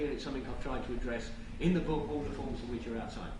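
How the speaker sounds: fast, hurried aside; rushed lightning talk. Real, not acted. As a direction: rattle it off quickly. Plugging book, hurrying on.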